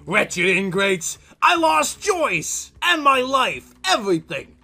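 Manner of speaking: loud, high wheedling plea; wheedling ghost. Acted. Ghost demanding to live with the couple. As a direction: coax them like a sly ghost.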